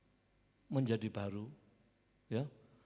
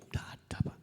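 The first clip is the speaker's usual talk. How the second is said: awed whisper; much faster than usual. Real, not acted. Awed confession.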